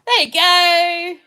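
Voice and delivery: high, loud and drawn out; slow, loud celebratory cheer; triumphant call. Real, not acted. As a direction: sing out the success, stretching the last word. Celebrating the app working now.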